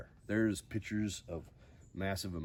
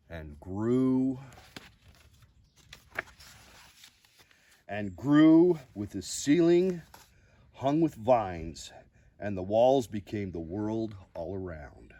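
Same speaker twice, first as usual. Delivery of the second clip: lively storybook reading with big rises; reading to children; 3 semitones higher, 8 dB louder than usual. Acted. Host reading a picture book to viewers, expressive storybook voice. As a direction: read the bedtime book aloud with drama.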